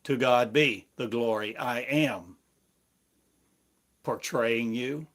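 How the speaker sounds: ordinary delivery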